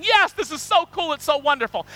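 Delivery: high, bright cheer of delight; excited neighbours. Acted. Preacher voicing the happy neighbours, excited. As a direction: gush with joy.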